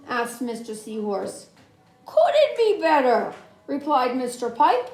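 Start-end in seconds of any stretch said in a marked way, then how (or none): 2.1-3.3 higher and louder, bursting with cheer; cheerful fish reply. Acted. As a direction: pop it out brightly happy. Mister Pipe answers cheerfully.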